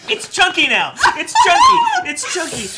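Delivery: giggling through words; laughing. Real, not acted. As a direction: laugh as you say it. Laughing at curdled drink.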